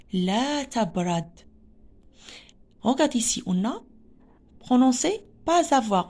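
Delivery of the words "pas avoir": "pas avoir" linked with a Z sound, pa-zavoir; liaison. Shown as a demonstration. Teacher shows pupils the S between vowels, liaison in "pas avoir" as pa-zavoir. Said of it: "On a fait une liaison".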